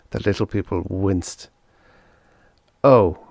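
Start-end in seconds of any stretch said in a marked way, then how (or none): 2.8-3.2 realising oh. A narrator's reading. Interpreter realising.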